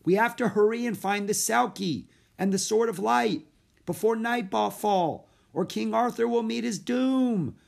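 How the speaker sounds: high, loud, urgent plea; urgent calling. A narrator's reading. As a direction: call after her with the stakes. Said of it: "Jack's voice trailed off".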